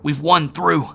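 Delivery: loud, high relief; triumphant cry; jubilant. A narrator's reading. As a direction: Howard exulting. Howard shouting in relief.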